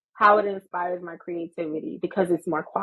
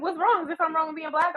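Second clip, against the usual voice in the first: indignant; 7 semitones higher, faster than usual. Retold or imitated. Indignant retold reply.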